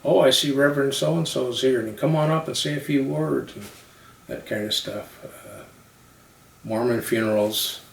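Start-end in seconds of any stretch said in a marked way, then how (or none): 0.0-3.6 higher, louder welcoming call; a hint of a speaker hailing a guest; inviting from the pulpit. Acted call from the funeral speaker.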